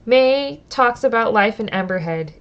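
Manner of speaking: reading the story text aloud, steady and clear; storyteller read; story narration. Acted. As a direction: narrate the scene for the audience. Reads the game text aloud.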